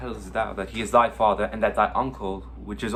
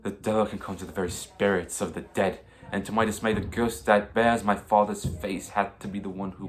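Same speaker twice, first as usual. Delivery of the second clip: usual speaking voice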